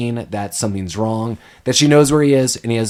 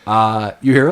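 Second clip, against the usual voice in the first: usual speaking voice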